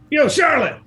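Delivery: high, loud holler; calling out. Acted. Spider husband calls his missus, loud high holler. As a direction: holler for someone far off.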